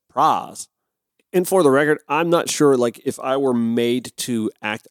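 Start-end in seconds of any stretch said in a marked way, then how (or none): 0.1-0.7 "praz" with one long open ah, no glide; older Georgian pronunciation. Shown as a demonstration. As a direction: say prize the old Georgia way, "praz". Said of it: "older Georgians pronounced the word prize as".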